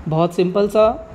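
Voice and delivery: ordinary talk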